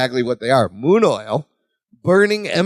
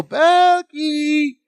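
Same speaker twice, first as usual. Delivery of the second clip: high, drawn-out mock call; Marianne; 10 semitones higher than usual. Wife calls her husband to bed, high mock call. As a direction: imitate a wife calling from another room, held high.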